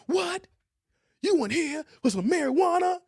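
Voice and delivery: mock outrage, stretching out "That's some bull"; incredulous rehab counselor. Quoting the counselor's disbelief at the pot addict. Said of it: "He's like".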